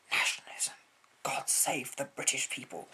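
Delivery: breathy patriotic whisper. Real, not acted. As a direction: whisper it like a secret vow.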